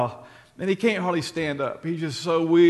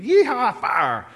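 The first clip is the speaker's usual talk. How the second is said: mock whoop; 8 semitones higher, 7 dB louder, much slower than usual. Mocking wild fire enthusiasm.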